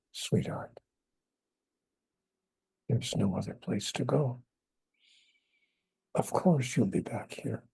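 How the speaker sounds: low, soft, tender reply from God; God's voice. Retold or imitated. God answering the speaker's worry about coming back, low and soft. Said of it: "And God said to me".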